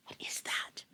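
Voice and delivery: a soft whisper, unsure what he heard; whispered wonder. Acted. Brahmin alone on the road, whispering. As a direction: breathe the question, listening hard. Brahmin hears a strange sound.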